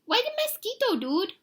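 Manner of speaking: extremely high, squeaky little voice; tiny Chihuahua. A narrator's reading. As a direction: pipe up as the smallest dog in the gang. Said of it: "the smallest of the small ones spoke up".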